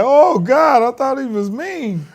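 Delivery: high, loud mock surprise; surprised exclaim. Retelling his own shock at the friendly extra, high and loud. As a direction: yelp it out in relief. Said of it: "He's like".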